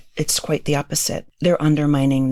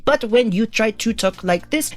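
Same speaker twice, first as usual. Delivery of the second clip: exaggerated rise and fall, higher; exaggerated intonation; 6 semitones higher than usual. Shown as a demonstration. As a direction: swing the intonation wide, on purpose. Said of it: "I'll exaggerate it right now".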